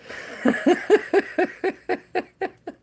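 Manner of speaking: high, long burst of real laughter. Real, not acted. Laughing at her own pope prediction, real giggle. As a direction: let a big laugh roll out.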